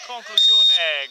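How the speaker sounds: slow, loud, stretched call; breathless italian goal commentary. Acted. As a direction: drag the word out as the shot flies. Goal called in live commentary.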